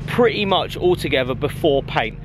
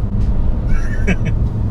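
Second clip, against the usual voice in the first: short laugh. Real, not acted. Laughs at own accent.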